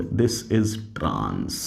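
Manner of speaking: teacher dictating the answer softly; very slow model answer. Shown as a demonstration. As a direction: stretch it out quietly as the answer. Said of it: "तब तुम क्या बोलोगे".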